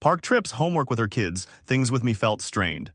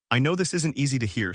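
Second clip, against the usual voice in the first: ordinary delivery, nothing marked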